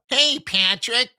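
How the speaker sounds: high, slow bratty twangy cartoon voice; nasal-free but bratty twang, like SpongeBob. Teacher imitates SpongeBob to show twang, bratty cartoon voice. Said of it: "that kind of bratty SpongeBob".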